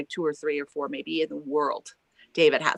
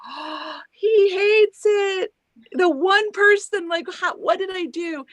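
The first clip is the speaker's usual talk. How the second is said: much higher, slowed, dismayed; mock panic as if he hates it; panicked inner thought; 13 semitones higher, 5 dB louder, slower than usual.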